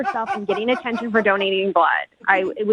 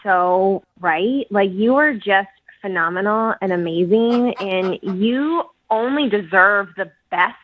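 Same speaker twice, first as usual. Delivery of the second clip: sarcastic. Real, not acted. Woman turning down her bragging blood-donor date, sarcastic. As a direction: gush sarcastically, stretching out the compliments. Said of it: "I get you're being sarcastic".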